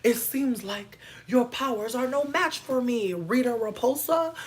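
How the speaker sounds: slow, high, mock-heroic boast; hero taunt. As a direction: deliver like a cartoon hero. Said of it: "playing a Power Rangers hero".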